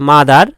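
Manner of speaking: "মাদার" as ma-dar, open আ at the end; second syllable of "মাদার" with আ, as -dar; a-sound ending. Shown as a demonstration. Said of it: "আ উচ্চারণ করে".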